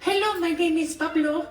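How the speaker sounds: high squeaky bird voice; chirpy little crow voice; Pablo's voice. Shown as a demonstration. Host demonstrates the ocean crow's high voice. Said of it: "his voice sounds like this".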